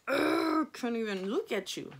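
mock disgusted groan, high and loud Ugh; fed-up wife can't stand the sight of him; disgusted at husband. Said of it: "you're just like".

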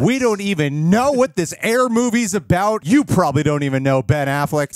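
high, loud reporter send-up; mock dismissive reporter. Reporter brushes off Affleck's new movie, mock high dismissal.

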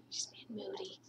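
soft whisper to the camera; whispered aside. Real, not acted. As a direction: whisper it so the dog won't hear. Aside while dog refuses.

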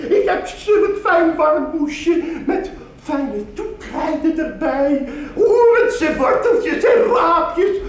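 very high, sing-song coaxing seller; wheedling vegetable woman. Acted. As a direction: sweet-talk the customer into buying. Vegetable woman sweet-talking buyer. (vleiende groenteverkoopster met hoge stem)

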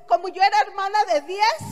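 ordinary talk

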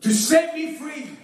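high, driving revival preaching. Real, not acted. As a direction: preach it like you feel it. Preaches at full force.

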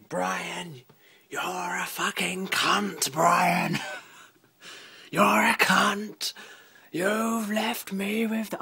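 hoarse furious screech, acted out; raspy, high impression of the angry neighbour; imitating a screaming woman. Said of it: "the woman screaming down the phone".

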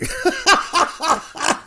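high shrieking laugh; burst of laughter. Real, not acted.

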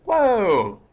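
high, loud startled gasp of surprise; startled whoa. Real, not acted. Gamer spots something strange, startled and loud. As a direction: jolt at the sight. Sudden strange sight in game.

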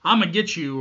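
mock attacker's shout; loud retold threat. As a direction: yell it as a threat. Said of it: "And he says".

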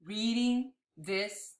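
tender storybook ending. Acted. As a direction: reveal it gently, phrase by phrase. Reveals book's closing secret.

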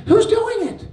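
high, loud demanding question; loud challenge. Retold or imitated. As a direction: call out the audience sharply. Challenging the audience.

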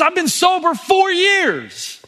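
high and slow, savoring "four years"; beaming with pride; proud announcement. Retold or imitated. Sober man proudly telling his pastor his milestone. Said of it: "He goes".

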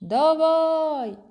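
long high call across a field; shouting. A narrator's reading. Boy calling his friend lost in the corn, shouting. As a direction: call out loud, drawing out the vowels. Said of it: "кричу".

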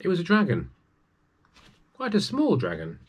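storyteller reveals a cute little dragon; delighted surprise. A narrator's reading.

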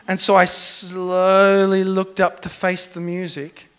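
normal talking voice

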